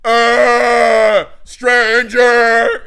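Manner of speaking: loud alarmed yells of stranger. Shown as a demonstration. Showing what kidnapped kids should yell, loud alarmed shout. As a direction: yell for help like a kidnapped kid. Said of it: "you're supposed to go".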